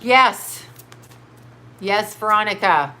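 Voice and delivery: ordinary talk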